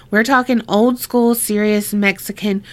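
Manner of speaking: ordinary delivery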